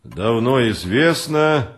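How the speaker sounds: slow, weighty reading aloud; solemn recitation. A narrator's reading. Writer reads from a book to his guests, solemnly. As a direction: draw each phrase out solemnly, like verse. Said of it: "торжественно прочитал".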